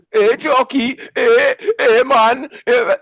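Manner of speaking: high, loud mocking comedian's call. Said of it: "man like Jim Davidson".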